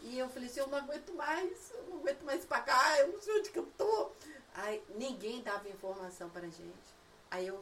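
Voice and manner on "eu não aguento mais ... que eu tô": high complaint climbing into a laughing mock wail; retold exasperation. Mother retelling being lost on the motorway, mock exasperation. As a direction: build to a hammed-up helpless whine.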